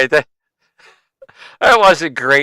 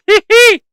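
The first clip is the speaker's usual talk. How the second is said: mock giggle; 7 dB louder than usual. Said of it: "I need a button that says".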